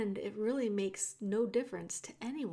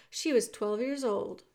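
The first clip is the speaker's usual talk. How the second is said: ordinary delivery, nothing marked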